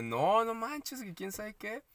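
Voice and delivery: high, slow, drawn-out brush-off; imitating a nonchalant co-worker, drawn out; mock dismissive. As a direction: play the co-worker shrugging it off, stretched and high. Woman from the electoral office brushing it off.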